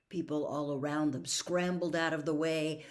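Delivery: ordinary delivery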